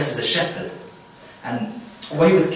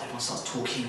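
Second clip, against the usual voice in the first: ordinary delivery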